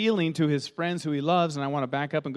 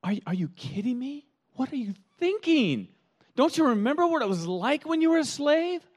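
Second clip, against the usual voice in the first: much higher, rising exasperation; incredulous; 10 semitones higher than usual. Retold or imitated. Asking a freed slave why he'd go back, pitched high and exasperated. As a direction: plead with a freed slave who wants chains back. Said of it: "you'd be thinking".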